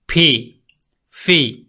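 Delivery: ফি with a loose f-like start; ফি, lips not fully closed; common mispronunciation. Shown as a demonstration. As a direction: say ফি as learners wrongly do. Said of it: "সঠিক উচ্চারণ করতে গিয়ে আমরা অনেকে বলে ফেলি".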